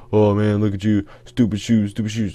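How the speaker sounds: lightly acted taunting of a kid's shoes; mock trash talk. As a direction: imitate a mouthy kid jeering. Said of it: "talking some mad shit".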